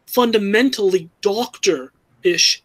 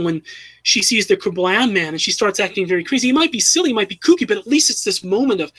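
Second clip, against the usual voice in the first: usual speaking voice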